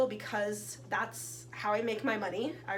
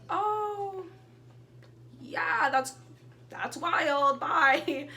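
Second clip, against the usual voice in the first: awkward exit; 6 semitones higher, 4 dB louder, much slower than usual. Retold or imitated.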